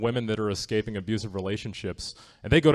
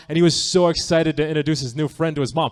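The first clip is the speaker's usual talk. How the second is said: normal talking voice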